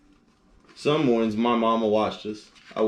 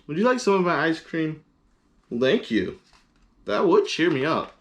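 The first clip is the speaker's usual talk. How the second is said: higher, sweet pig voice; Piggy offering; 7 semitones higher than usual. Acted. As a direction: kindly offer a treat. Piggy offers, Gerald answers.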